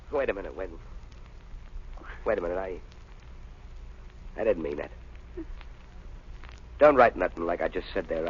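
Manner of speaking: backing down. Acted. Keegan backs off after outburst.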